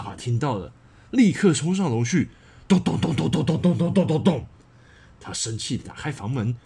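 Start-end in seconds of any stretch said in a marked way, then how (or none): 2.7-4.5 steady heavy 咚 beats falling at the end; stomping footstep sounds. A narrator's reading. Angry dad charging up the stairs, stomping footsteps. Father rushing upstairs.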